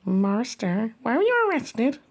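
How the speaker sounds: ordinary delivery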